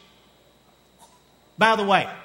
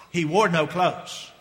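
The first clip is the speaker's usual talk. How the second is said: slow repeat; slower than usual. Acted. Repeated slowly for effect.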